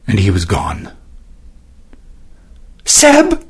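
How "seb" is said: high desperate scream of his name; crying out. A narrator's reading. Sister crying out for her brother while sliding, high scream. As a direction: scream after him. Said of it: "she cried as she slid".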